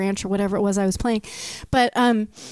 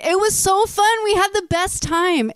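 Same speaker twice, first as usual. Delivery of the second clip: gushing excited; 7 semitones higher than usual. Retold or imitated. Fans gushing after the gig.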